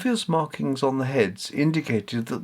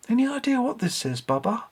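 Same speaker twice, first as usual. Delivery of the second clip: lightly voiced boy, higher and curious; a boy eagerly asking for help, pitched up; Richard, curious boy; 11 semitones higher than usual. Richard showing Bubba the inscription, curious higher voice.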